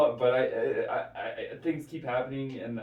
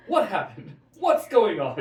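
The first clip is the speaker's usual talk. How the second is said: higher, louder, bewildered newsman; Tucker Carlson impression; 8 semitones higher, 11 dB louder than usual. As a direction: play him baffled and indignant. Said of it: "parody of Tucker Carlson".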